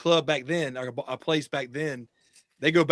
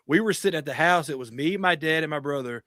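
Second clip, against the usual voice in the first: ordinary delivery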